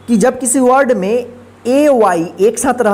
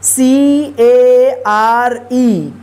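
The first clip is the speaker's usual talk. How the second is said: letters spelled one by one, very slow; spelling out letters; much slower than usual. Shown as a demonstration. Teacher spelling a word aloud slowly. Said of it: "care".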